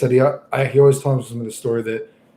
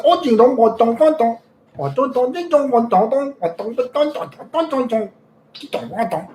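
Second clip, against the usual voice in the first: high sing-song mock gibberish; much higher mock chatter of the grandma; 11 semitones higher than usual. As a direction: chirp nonsense syllables up high. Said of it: "she's like".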